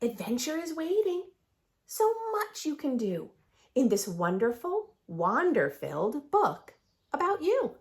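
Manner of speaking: lively sing-song picture book read; reading to children. A narrator's reading. Teacher reads the rhyming book to her class. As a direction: read the rhymes aloud with bounce.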